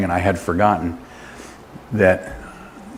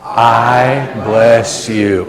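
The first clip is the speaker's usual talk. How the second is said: very slow, chant-like, sustained words; leading in unison; much slower than usual. Real, not acted. Preacher leads the blessing after calling for power, sustained. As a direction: hold every word out for the room.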